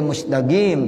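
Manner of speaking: Egyptian "mustaqim", said as musdagim; hard g for the qaf in "mustaqim"; Egyptian dialect. Shown as a demonstration. Teacher imitating Egyptians, "mustaqim" in Egyptian dialect. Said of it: "itu dialeknya seperti itu".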